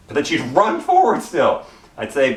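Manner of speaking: ordinary delivery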